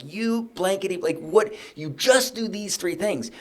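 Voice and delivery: mock-irritated boss scolding; frustrated. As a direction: retell losing patience with team. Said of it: "I'm frustrated".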